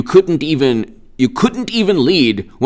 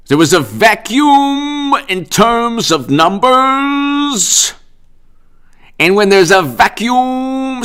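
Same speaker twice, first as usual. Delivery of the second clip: slow, higher, stretched pompous speech; mock pompous governor; 5 semitones higher, slower than usual. Vlogger imitating the governor's press conference, slow and pompous. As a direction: draw out vacuum with grand pauses. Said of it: "So then he goes".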